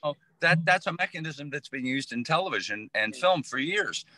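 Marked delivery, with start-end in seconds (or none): none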